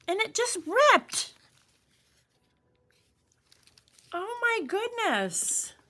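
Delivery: high, laughing disbelief; giggly shocked exclamations; shocked laughter. Real, not acted.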